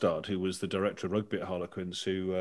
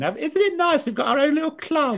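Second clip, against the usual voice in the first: camp gushing fans; 10 dB louder than usual. Retold or imitated. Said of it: "seven gays and lesers at Harlequins going".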